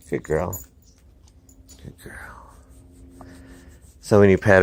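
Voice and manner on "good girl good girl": much softer, lower murmured praise; gently reward her as she settles. Real, not acted. Trainer quietly praising his calm dog. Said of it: "soft praise to dog".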